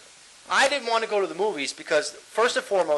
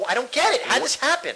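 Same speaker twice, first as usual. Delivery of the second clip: ordinary talk, nothing marked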